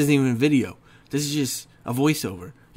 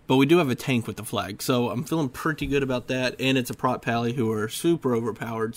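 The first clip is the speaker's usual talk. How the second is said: usual speaking voice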